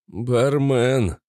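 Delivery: plain reading voice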